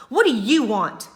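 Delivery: loud angry snap at his sister; loud, irritated brother snapping; snapped. Acted. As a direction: bite the words off at her. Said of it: "he snapped".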